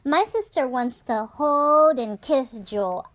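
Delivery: usual speaking voice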